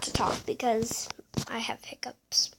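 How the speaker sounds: soft whisper through hiccups. Real, not acted. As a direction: whisper so as not to talk. Gamer quiets down while playing.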